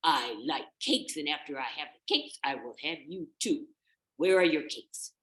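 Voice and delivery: deliberate villainous relish; hungry wolf. Acted. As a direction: savor each word as a threatening wolf. Wolf answers.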